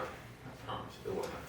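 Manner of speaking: usual speaking voice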